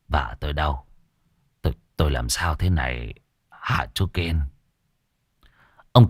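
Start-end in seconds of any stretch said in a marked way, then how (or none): 0.1-4.4 low, soft, faint voice; husband waking up, low and soft; weak and dazed. Acted. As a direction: speak like just coming round from a faint. Dazed man just woken from faint.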